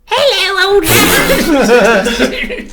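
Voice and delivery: very high, loud British screech; hammed-up piercing British hello; over-the-top British accent. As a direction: shriek a greeting in absurd British falsetto. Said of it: "More Brit-, more British".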